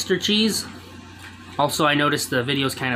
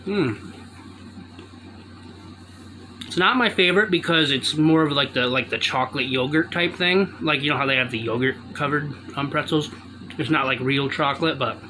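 muffled behind fist; talking with mouth full. Real, not acted. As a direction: hand covering mouth. Seen 3: Fist covers mouth while chewing pretzel throughout the labelled words.

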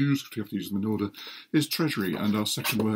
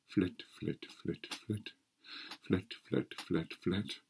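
soft breathy tiptoe words; whispered flit sounds; 3 semitones lower, 11 dB quieter, slower than usual. Acted. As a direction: breathe each flit like tiny steps. Acts out flat man walking.

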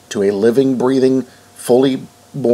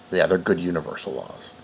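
sarcastic; 4 semitones lower, 9 dB quieter than usual. Retold or imitated. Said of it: "gave me so much grief".

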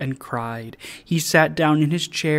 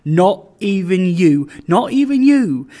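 loud, jolly protest climbing higher on the repeat; 4 semitones higher, 7 dB louder than usual. A narrator's reading. Suitor rushing in to claim the first kiss, jolly. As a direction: cut in heartily, rising to high and loud. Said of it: "a generous and jolly voice".

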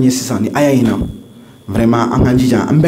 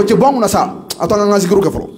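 higher-pitched, mock-annoyed complaint; irritated worshipper; 7 semitones higher than usual. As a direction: act out someone fed up with an interruption. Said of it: "Misiri kɔnɔ bɛɛ bɛ énervé yɛrɛ de". (dimi kan na)

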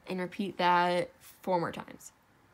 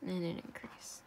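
whispering; 8 dB quieter than usual. Real, not acted. Quiet aside while working.

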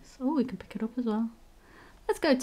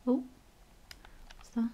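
usual speaking voice

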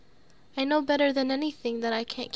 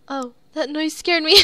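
startled; 2 semitones higher, 9 dB louder than usual. Real, not acted. Startled by noise.

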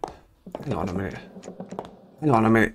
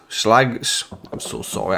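normal talking voice

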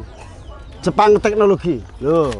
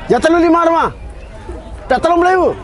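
hawker price call; 9 semitones higher, faster than usual. Real, not acted. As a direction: shout the bargain to the crowd. Vendor calling out lowered prices. (nawakake rega banter lan dhuwur)

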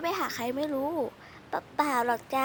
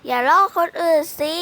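scolding; 2 semitones higher, 11 dB louder than usual. Acted. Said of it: "อย่าทำเสียงนักเรียนนะ".

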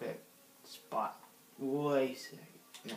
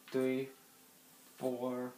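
ordinary delivery

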